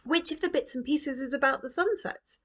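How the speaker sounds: put-on a young girl's wondering question; child's voice. A narrator's reading. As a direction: Roo asking which things hold the sunsets, childlike. Roo asks Grandpa.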